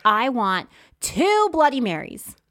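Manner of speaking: loud, slow, emphatic demand for drinks; demanding order. Retold or imitated. Seatmate ordering from the flight attendant, loud and slow. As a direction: lean hard on two, drawn out.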